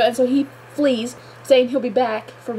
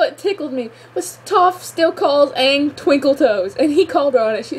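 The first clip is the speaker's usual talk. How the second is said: normal talking voice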